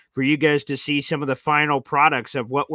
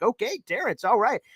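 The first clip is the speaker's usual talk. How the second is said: higher, humoring okay; indulgent okay; 6 semitones higher than usual. Retold or imitated. Host humoring an overexcited caller, higher and indulgent. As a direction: humor him gently. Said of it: "You're like".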